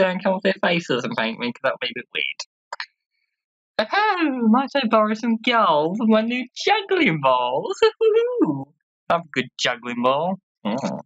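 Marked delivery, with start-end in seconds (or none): 3.9-8.7 very high, drawn-out jester voice; high, theatrical juggler with a silly hoot; gleeful juggler. Acted. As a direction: ask in a high, hammed-up voice, ending in hooting laughter. Voices a juggler begging for gold.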